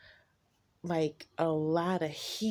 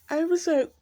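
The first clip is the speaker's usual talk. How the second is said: louder and higher, exclaiming about shrinking; loud shocked telling; 3 semitones higher, 7 dB louder than usual. Real, not acted. Recalling her shock at him, loud and high. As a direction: blurt it out in disbelief.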